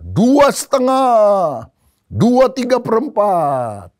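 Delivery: very slow, high, loud count; stretched-out countdown. Acted. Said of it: "lalu dia mulai hitung". (hitungan lantang, tinggi, diulur panjang)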